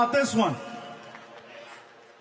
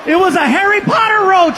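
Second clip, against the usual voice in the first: high, much louder outraged shout; shouted punchline; 9 semitones higher, 12 dB louder than usual. Real, not acted. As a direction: blast the punchline.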